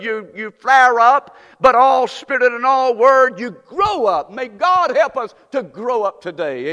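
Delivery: loud, high, driving preacher's exhortation; full-force preaching. Acted. Preacher urging the church to get in the Word, full-force preaching. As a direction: preach it hard to the congregation, rising to a climax.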